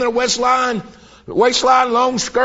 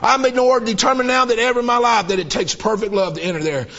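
ordinary delivery

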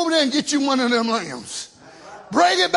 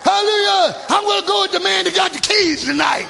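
full-force preaching; 3 semitones higher than usual. Acted. Preacher shouting to the church. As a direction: drive it home to the congregation.

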